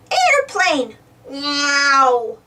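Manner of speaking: put-on excited toddler voice; lightly voiced little boy shouting happily; little George. A narrator's reading. George sees the plane, excited little voice. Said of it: "says George".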